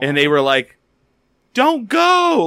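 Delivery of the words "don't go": loud, high pleading shout; imitating crowd yell. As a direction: yell it like fans begging her to stay. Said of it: "people started yelling".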